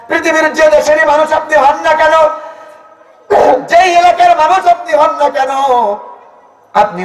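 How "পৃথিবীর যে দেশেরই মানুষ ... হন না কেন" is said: climbing high sing-song sermon; chanting praise of the Prophet; fiery preaching. Acted. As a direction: build it up and hold it high.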